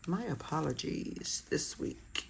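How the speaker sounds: unvoiced, slow whispered apology. Real, not acted. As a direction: breathe the apology out. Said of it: "I'm just burping".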